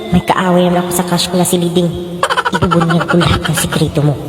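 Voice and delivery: very low, secretive thought; scheming friend's inner voice. Acted. Friend plotting to expose a rival, low inner voice.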